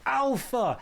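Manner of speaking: high robot call of Alpha; hint of a squeaky robot call; robot catchphrase. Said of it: "always said".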